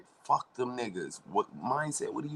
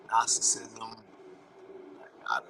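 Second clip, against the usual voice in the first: whisper; 3 semitones higher than usual. Real, not acted. Dropping to a whisper as he names the idea for viewers. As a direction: say it in a breathy whisper.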